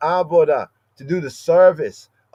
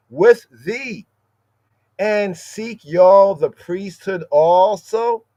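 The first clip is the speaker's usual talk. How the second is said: solemn scripture reading; 5 semitones higher, 5 dB louder, much slower than usual. Acted.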